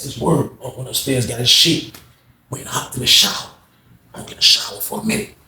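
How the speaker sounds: normal talking voice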